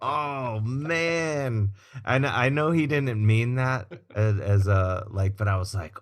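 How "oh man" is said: high, drawn-out mock dismay; crestfallen. Guitarist reacting to his idol praising only his jacket, crestfallen. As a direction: let the words stretch out high and wounded. Retells reaction to dodged compliment.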